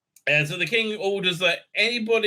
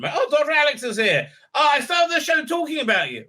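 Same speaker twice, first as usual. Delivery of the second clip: excited greeting; 11 semitones higher than usual. Real, not acted. Host spotting Doctor Alex arrives, greets him excitedly. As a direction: greet a friend who just walked in, thrilled.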